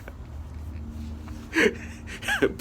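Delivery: soft, high wheeze of laughter; real laughter. Real, not acted. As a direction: laugh yourself out of breath. Cracks up at his own story.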